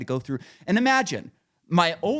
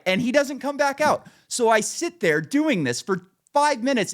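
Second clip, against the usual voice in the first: ordinary talk, nothing marked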